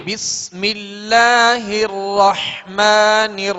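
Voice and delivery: slow, drawn-out chanted Quran verse; slow tajweed recitation. Shown as a demonstration. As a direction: chant each word long and melodic. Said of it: "recites Bismillah and verse".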